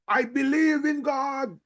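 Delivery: mock showy declaration, pitched high; loud confession. Pastor mocking a showy confession of faith, pitched high. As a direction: proclaim your faith for show, a little hammed. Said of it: "It is not how loud you confess".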